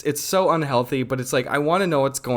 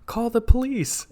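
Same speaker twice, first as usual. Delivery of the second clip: mock yell; 9 semitones higher, slower than usual. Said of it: "yelled out and said".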